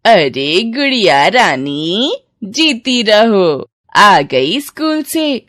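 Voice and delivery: loud, drawn-out cooing greeting; doting old aunt. Acted. Aunt greeting the little girl home from school, slow doting. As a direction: stretch every word in fond elderly fuss.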